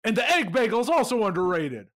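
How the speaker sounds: mock shout. Shouting bagel opinions in mockery.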